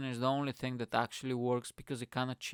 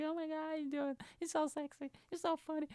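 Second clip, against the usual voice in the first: squeaky high fangirl gushing, much higher and softer; mock swooning fan girl; 3 dB quieter, faster than usual. Starstruck woman gushing over him after his gig, mock squeaky high voice. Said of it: "she pops at one of my gigs and be like".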